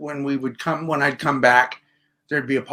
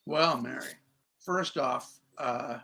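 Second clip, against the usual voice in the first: mock solemn; 5 semitones lower, 10 dB quieter than usual. Mock solemn address to caller.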